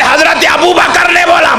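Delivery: high mock shout; maulana's retort. Maulana retorting in the debate, high mock shout. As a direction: throw the answer back hard.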